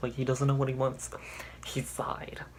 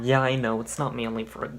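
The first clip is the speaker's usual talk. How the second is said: usual speaking voice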